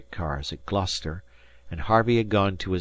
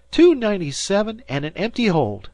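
high, loud triumphant tally; shouting; 12 semitones higher, 6 dB louder than usual. A narrator's reading. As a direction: shout the count across the deck.

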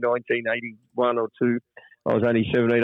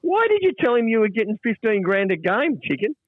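higher, accusing imitated voice; stern boss; 10 semitones higher than usual. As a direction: demand an explanation. CEO and coach confronting him.

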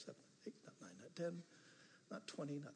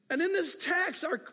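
usual speaking voice

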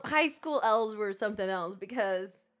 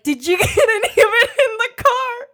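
quoted through loud laughter; cracking up mid-quote; laughing so hard the words break; 12 semitones higher, 10 dB louder than usual. Retold or imitated. Mocking guy's quote while laughing.

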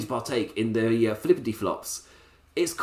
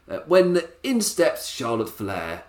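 ordinary talk, nothing marked

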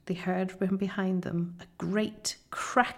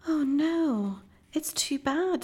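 high, plaintive lament; a touch of a child's sob, pitched up; mild acted sobbing; 8 semitones higher than usual. A narrator's reading. Lucy finding the body gone, lightly acted sob. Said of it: "sobbed Lucy".

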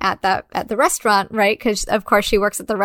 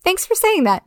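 lightly voiced Allie; 9 semitones higher than usual. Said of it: "kind of like".

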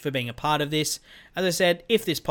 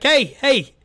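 louder, higher hammed-up DM accent; mock an eager fan in a silly accent; goofy put-on accent, loud and high; 3 semitones higher, 8 dB louder than usual. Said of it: "I'm getting into that accent".

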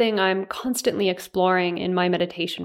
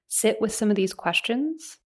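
usual speaking voice

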